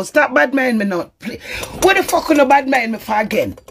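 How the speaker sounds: ordinary talk, nothing marked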